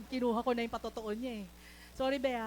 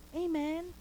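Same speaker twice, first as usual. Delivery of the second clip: normal talking voice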